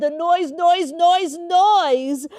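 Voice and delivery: fed-up outburst over the racket; exasperated. A narrator's reading. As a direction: throw your hands up at the din. Narrator mimics hated noise.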